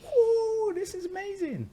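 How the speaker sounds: very high-pitched awed whoop; acted amazement. Reliving his light body in the water, acted amazement. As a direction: exclaim in high, mock-wide-eyed wonder.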